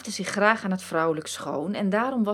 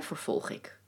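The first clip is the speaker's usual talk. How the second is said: ordinary delivery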